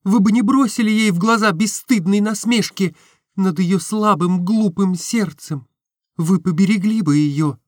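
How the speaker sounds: put-on young woman pleading in anguish; Nastenka pleading. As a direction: plead her case, rising. Nastenka's long plea.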